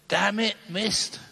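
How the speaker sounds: the Pope cursing his near-miss, high and slow; exasperated curse. Retold or imitated. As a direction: let the frustration stretch out.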